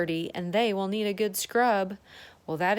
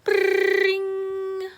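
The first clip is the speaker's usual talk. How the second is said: high loud mock alarm ring; playful alarm clock imitation; 11 semitones higher, 8 dB louder than usual.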